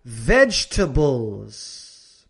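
very slow VEGETABLES as VEJ-tuh-bulz; careful teacher's VEGETABLES in three beats; plural, silent E. Shown as a demonstration. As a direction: draw out VEGETABLES, ending on z. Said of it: "En plural".

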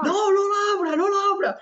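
high, frantic yelling; panicked shout. Retold or imitated. Narrator to flatmate Edu at the door, panicked high yell. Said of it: "Me pega un portazo".

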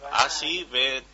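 usual speaking voice

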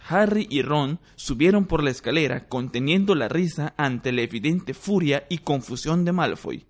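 usual speaking voice

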